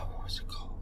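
whispered to himself; whispering. Real, not acted. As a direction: whisper the question. Trying to remember a name.

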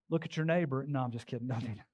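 low, soft throwaway tease; joking aside. Real, not acted. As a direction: drop down and back off the joke.

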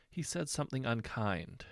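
plain reading voice

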